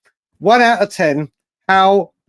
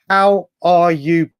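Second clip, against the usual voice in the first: ordinary delivery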